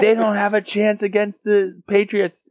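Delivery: high, drawn-out mock yell; imitated screaming. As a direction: imitate hecklers screaming down the street. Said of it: "people are just screaming at me".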